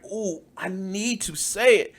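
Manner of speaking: softly aching with mock need; mock craving. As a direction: play a hushed, strained craving. Mocking someone itching to say it.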